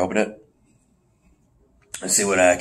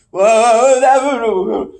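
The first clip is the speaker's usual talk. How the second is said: high, drawn-out wail; hammed-up pain; mock yelp; 8 semitones higher, 3 dB louder than usual. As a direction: cry out as if pricked. Old man pricks his finger.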